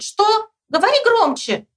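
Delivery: very high-pitched calling; high storybook shout; shouting back. A narrator's reading. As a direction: yell back to someone riding past. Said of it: "и кричит".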